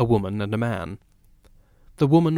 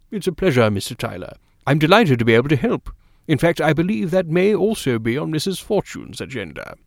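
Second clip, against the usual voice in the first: pitched-up, obliging doctor's voice; 5 semitones higher than usual. Acted. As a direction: answer as the delighted doctor, lifted in pitch. Doctor replies to the patient.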